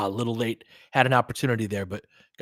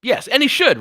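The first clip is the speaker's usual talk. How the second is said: much higher and louder, pressing the point; fired-up insistence; emphatic outburst; 11 semitones higher, 11 dB louder than usual. Real, not acted. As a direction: jump in hard to agree. Heated debate on Lakers power.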